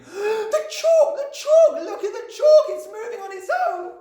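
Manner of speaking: shrill, very high schoolboy shriek; hysterical Nigel screaming. A narrator's reading. Nigel pointing at the blackboard, shrill hysterical scream. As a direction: scream in excited terror at the sight. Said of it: "hysterical and shrill was Nigel's scream".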